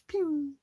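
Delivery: high sharp ricochet sound effect; bullet ricochet. Shown as a demonstration. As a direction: zip it out like a bullet glancing off. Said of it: "Yeah, ricochet noise".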